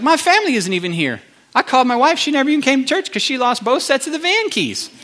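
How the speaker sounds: high-pitched comic rant, climbing as it goes; mock ranting. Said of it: "I was ranting to him".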